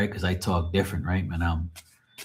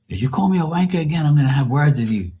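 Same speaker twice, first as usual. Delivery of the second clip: imitated Scouse warning, higher and brisk; Liverpool accent; 6 semitones higher than usual. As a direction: threaten like a Liverpool foreman. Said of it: "he was from Liverpool in England".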